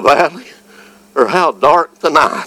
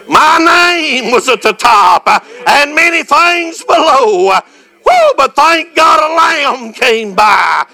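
preaching; 14 semitones higher than usual. Acted. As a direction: preach it out with full fire. Sermon climax, shouted praise.

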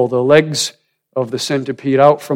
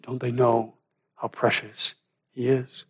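very soft, hushed wonder; hushed tenderness; 9 dB quieter than usual. Acted. Woman thinks of Jesus while kissing his feet, hushed. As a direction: let it sink almost to nothing.

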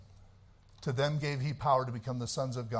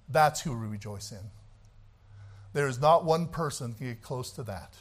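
ordinary talk

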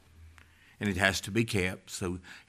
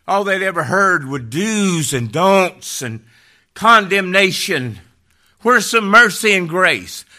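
loud, high, impassioned pulpit cry; ringing, raised preacher's voice; preaching at full force; 8 semitones higher, 11 dB louder than usual. Real, not acted. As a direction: cry it out to the whole congregation.

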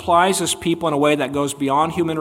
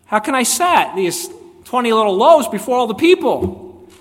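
doubting servant; 7 semitones higher, 6 dB louder than usual. Retold or imitated. Doubting servant questions Elisha.

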